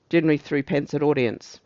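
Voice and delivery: usual speaking voice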